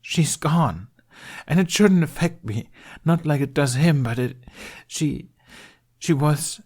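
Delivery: put-on a boy trying not to cry over her loss; fighting back tears. A narrator's reading. Obi-Wan to Ben, choking back tears over her. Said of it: "he heaved in a shaky breath, determined not to cry".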